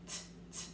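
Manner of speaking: "t, t" as a voiceless tap; isolated t sound. Shown as a demonstration. Teacher sounding out the final "t", whispered. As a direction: clip the t sound alone. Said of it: "I hear that T sound".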